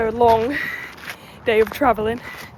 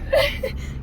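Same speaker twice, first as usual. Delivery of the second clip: high airy laugh. Real, not acted. Retelling compliment, laughing.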